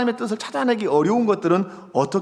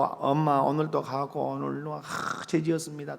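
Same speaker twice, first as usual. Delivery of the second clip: imitating a dejected child, much softer and lower; mock sorry child; 3 semitones lower, 8 dB quieter than usual. Child coming to mom daily to confess, mock sorry and subdued. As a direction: sigh through a hushed, half-hearted confession. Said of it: "맨날 자식이 와갖고".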